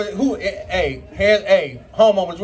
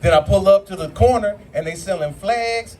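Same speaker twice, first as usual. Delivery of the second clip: ordinary talk, nothing marked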